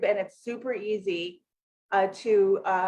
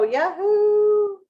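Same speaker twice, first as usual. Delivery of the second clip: high, loud yahoo of triumph; triumphant whoop; excited whoop; 12 semitones higher, 7 dB louder than usual. Real, not acted. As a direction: cheer as it finally loads. Found the live stream.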